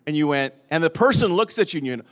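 usual speaking voice

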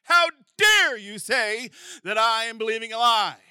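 mock-indignant how dare you; defensive retort. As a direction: bristle like someone caught out. Offended listener snaps back defensively.

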